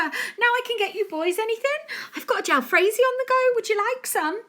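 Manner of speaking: cheery mum voice. A narrator's reading. Mum's voice in story.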